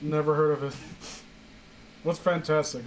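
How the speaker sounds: ordinary talk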